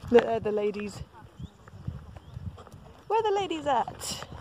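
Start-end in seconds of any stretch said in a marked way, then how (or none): 3.1-4.3 sing-song call. Real, not acted. Calling after the female alpacas.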